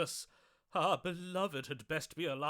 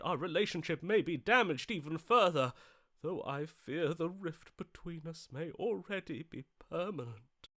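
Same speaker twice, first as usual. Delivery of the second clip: ordinary talk, nothing marked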